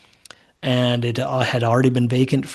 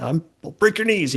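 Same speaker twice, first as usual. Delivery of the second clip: higher-pitched mock threat; a hint of a menacing collector, pitched up; debt collector; 6 semitones higher than usual. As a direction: lightly play a knee-breaking debt collector. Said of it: "I'm not the debt collector".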